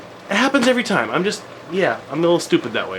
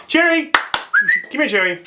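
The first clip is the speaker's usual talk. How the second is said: calling out; 11 semitones higher, slower than usual. Real, not acted. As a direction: call the dog over brightly. Calling the pet.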